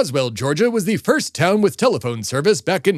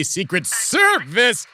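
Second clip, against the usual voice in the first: indignant outburst; 5 semitones higher, much slower than usual. Acted. Being searched by the Secret Service.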